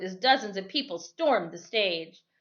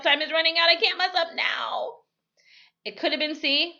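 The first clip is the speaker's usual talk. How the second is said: higher and louder in rising panic; racing against the clock, voice climbing; 10 semitones higher, 5 dB louder than usual. Acted. Girl panics over the final quiz answer, higher and louder.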